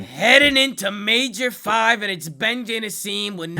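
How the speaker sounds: loud, high, slow announcer call; hype announcer. Acted. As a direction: boom it out like a broadcaster.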